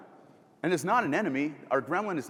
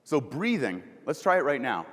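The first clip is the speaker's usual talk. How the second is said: ordinary talk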